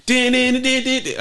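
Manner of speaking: high sung din din; imitated driving music. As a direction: hum the racing tune high. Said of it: "I don't know why I'm doing sonically".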